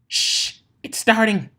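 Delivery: sharp shush, then high, eager squeal; excited hush. A narrator's reading. Pinkie hushing her friends as the show begins, excited.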